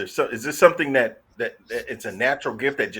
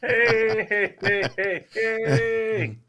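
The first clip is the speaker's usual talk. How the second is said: goofy greeting; 6 semitones higher, much slower than usual. Acted. Silly sing-song greeting.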